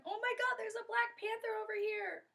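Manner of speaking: very high, loud alarm cry; panicked yell. Acted. Kondoma to her friend about the panther, panicked yell. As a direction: yell a warning across the trees. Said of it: "she yells out to her friend".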